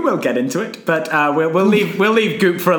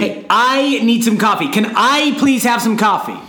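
hammed-up coffee plea; 5 semitones higher than usual. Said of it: "much better than Tom Holland did".

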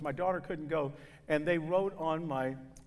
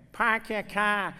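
northern twang imitation; 4 semitones higher, slower than usual. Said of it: "the northern twang".

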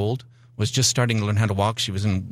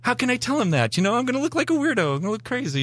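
high, fast, whining complaint; mock whine, pleading not to look foolish; whiny protest; faster than usual. Arguing with God while cleaning the church, high mock whine. Retelling his argument with God.

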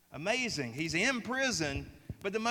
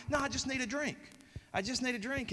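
higher, softer schoolgirl insisting; a student pleading with her teacher for a drink, higher and softer; 7 semitones higher, 6 dB quieter, faster than usual. Retold or imitated. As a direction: imitate a kid quietly insisting.

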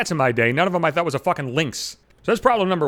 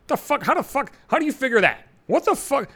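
incredulous; 8 semitones higher than usual. Real, not acted. Outraged at being called dumb.

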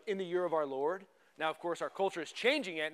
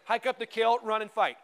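ordinary talk, nothing marked

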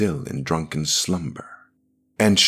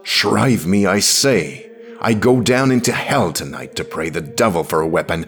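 louder, insistent plea at her own pitch; urgent demand; 5 dB louder than usual. Acted. Woman kneeling before the priest, urgent demand. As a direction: press it hard and forcefully.

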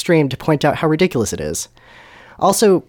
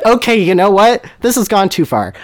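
higher, louder exasperation; fed-up protest; 8 semitones higher, 5 dB louder than usual. Acted. Voldemort halts the argument after a sigh, exasperated. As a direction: call a halt, exasperated.

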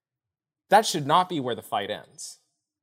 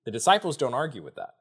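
normal talking voice

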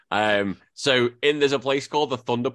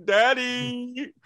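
high, hammed-up crying for daddy; exaggerated tearful whine; mock wail; much slower than usual. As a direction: sob like a child calling its father. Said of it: "I was like".

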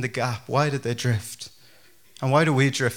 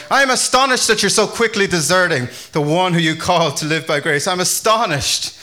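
annoyed astonishment, raised and loud; Paul; 5 semitones higher, 6 dB louder than usual. Acted. Paul skipping pleasantries to scold the Galatians, annoyed and astonished. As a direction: launch straight into an indignant rebuke. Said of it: "He's so annoyed".

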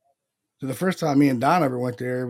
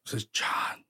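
hushed imitation of a ghostly recording; whispery imitation; 7 dB quieter, slower than usual. Investigator mimicking the voice that says his name, whispered. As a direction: whisper it without voice. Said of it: "like real whispery".

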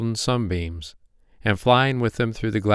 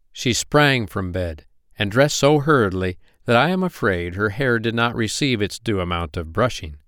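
ordinary delivery

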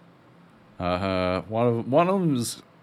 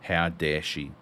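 low muttering Bond; 7 semitones lower, 8 dB quieter than usual. Retold or imitated.

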